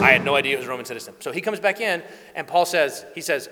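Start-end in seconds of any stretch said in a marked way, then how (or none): 0.0-1.1 rushed, very fast blurt; guard rushing his words to the tribune, very fast; panicked blurt. Acted. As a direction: spill it out all at once in a panic.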